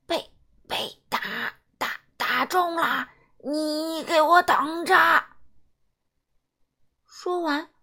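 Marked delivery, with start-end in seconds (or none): 0.1-4.9 stammering beaten villain. Acted. Defeated alien stammers and threatens.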